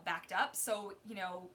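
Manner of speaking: ordinary talk, nothing marked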